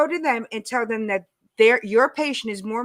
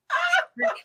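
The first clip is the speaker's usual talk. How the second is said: laugh. Real, not acted. Laughs after her outburst.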